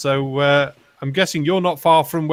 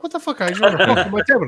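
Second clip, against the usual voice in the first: high, exasperated mock Italian accent; outraged tourist in a hammed-up accent; 6 semitones higher than usual. Italian tourist protesting to the waitress, high mock accent. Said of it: "the Italian".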